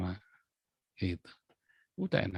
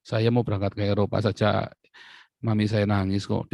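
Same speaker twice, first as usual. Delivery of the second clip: ordinary talk, nothing marked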